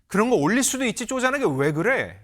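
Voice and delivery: high-pitched indignant wife, acted out; brazen retort. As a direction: turn the blame back on him. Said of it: "적반하장이죠".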